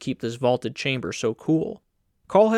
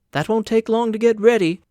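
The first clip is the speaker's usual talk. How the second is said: a hint of a brisk, higher woman's voice; light, bright-toned landlady, a little louder; lightly voiced woman; 7 semitones higher, 5 dB louder than usual. A narrator's reading. Landlady to Carl, lightly voiced and brisk.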